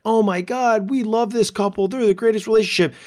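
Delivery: mock the starry-eyed fan who adores the couple; gushing fan. Social media fan gushing over a perfect couple, high and fast. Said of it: "you look at couples on social media and you're like".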